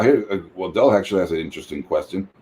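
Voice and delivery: usual speaking voice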